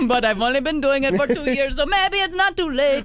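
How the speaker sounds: mock singer talking in Middle Eastern reggae lilt; Middle Eastern reggae accent. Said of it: "It's your Middle Eastern reggae".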